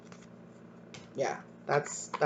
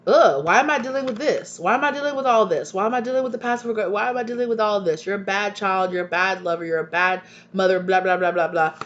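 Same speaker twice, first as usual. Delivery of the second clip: mock whiny complaint, pitched up and hurried; exasperated complainers; 4 semitones higher, 4 dB louder, faster than usual. People around you throwing blame back at you, exasperated. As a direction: imitate fed-up people, higher pitched and rattled off fast.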